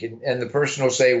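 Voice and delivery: ordinary talk, nothing marked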